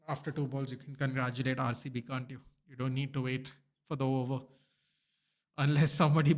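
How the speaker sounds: usual speaking voice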